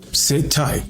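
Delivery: ordinary talk, nothing marked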